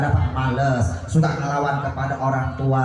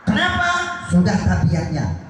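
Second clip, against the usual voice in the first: high rhetorical question. Acted. Preacher's high question to crowd.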